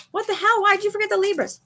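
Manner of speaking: high, soft mock outrage in a put-on voice; imitating her friend. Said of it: "I heard her going".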